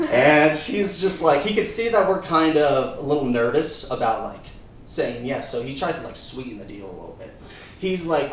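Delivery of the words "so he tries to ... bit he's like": ordinary delivery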